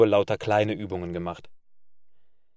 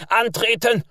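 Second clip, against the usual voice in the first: much higher, loud yelled command; shouted order; 12 semitones higher, 5 dB louder than usual. A narrator's reading. Officers yelling the order after the sergeant, high shout. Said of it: "schreien jetzt die anderen Unteroffiziere".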